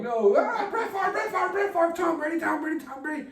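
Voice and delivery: very high mock pundit chanting names; squeaky sports pundit. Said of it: "they were always just like".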